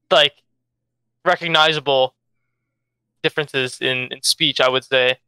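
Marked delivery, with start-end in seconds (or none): none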